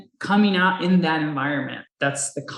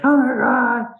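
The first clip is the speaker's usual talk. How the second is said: high, loud imitation of weeping; mock crying; 7 semitones higher, 5 dB louder than usual. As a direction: wail it like an overwhelmed crier, mockingly. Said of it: "he's all, like, crying and stuff".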